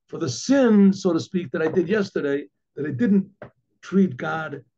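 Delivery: usual speaking voice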